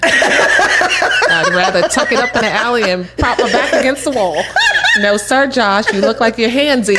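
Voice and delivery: refusing to stay at his place, laughing; laughing refusal. Retold or imitated. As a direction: turn him down through laughter. Refusing Josh's offer, laughing.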